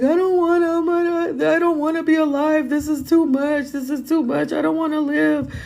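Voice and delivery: high, choked crying plea; high desperate sobbing voice; retold crying. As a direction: wail it out like you're breaking down. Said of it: "I was just crying".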